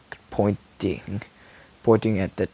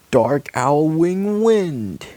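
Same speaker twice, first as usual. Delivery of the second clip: very slow, louder dramatic call; power move call; 6 semitones higher, 4 dB louder, much slower than usual. Acted. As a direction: intone the attack name. Superpower call.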